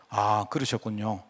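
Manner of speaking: empathetic reply. Shown as a demonstration. A pastor showing the congregation how to answer with empathy, soft and slow. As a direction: answer gently like you truly feel for them. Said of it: "맞장구를 쳐주는 겁니다".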